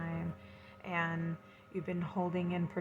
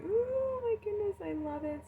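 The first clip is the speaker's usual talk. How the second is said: much higher, louder, thrilled gasp of joy; delighted squeal; 4 dB louder than usual. Real, not acted. Delighted by a new card.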